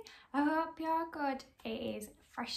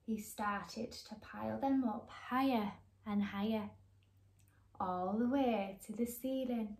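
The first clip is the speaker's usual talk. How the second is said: put-on lively read-aloud to children; bright, animated storybook reading; story narration; 2 semitones lower, 3 dB louder than usual. A narrator's reading. Reading a picture book to young viewers, lively.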